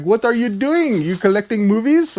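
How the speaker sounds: high-pitched imitation of the mail lady. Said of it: "The mail lady was like".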